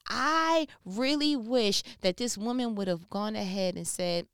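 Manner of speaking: ordinary delivery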